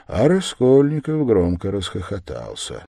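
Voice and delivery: plain reading voice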